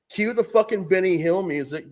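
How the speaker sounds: ordinary talk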